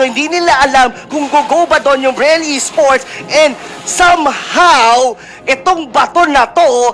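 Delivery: high, driving esports commentary; live play-by-play. Real, not acted. Co-caster explains the tense match to viewers, live play-by-play.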